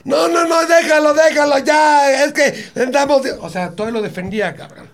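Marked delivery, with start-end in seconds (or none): 0.0-3.3 high, loud mock shriek from the girlfriend; hammed-up girlfriend yelling to stop the fight; girlfriend shouting. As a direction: shout no no no, let him go. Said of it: "¿Y la chava qué hacía?".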